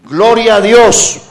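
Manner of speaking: a high, drawn-out shout of praise; loud praise. Real, not acted. Preacher to congregation giving glory to God, loud exclamation.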